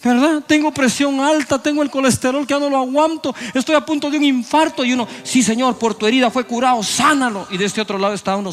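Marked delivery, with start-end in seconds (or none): 0.0-4.8 high, pleading man begging to be healed; desperate sick man. Acted. Sick glutton pleads with God for healing, high and desperate. 5.2-7.5 high, fervent helper agreeing in prayer; eager intercessor. Acted. Believer agrees with the sick man's prayer, high and fervent.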